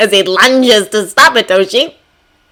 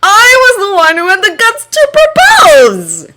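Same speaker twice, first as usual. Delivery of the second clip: cheerful husband voice; 12 semitones higher, 6 dB louder than usual. A narrator's reading. Hisashi's voice.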